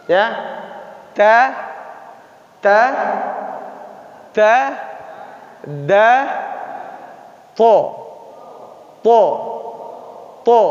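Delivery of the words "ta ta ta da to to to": ta, da, to at the same tongue position; letter drill. Shown as a demonstration. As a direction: drill each letter with pauses. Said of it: "Masih di posisi yang sama".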